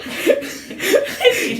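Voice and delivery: wheezing high-pitched laugh; breathy high laugh. Real, not acted. Cracking up at the shouting match, wheezing laugh. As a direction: let out a breathless squeal of laughter.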